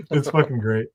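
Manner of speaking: ordinary delivery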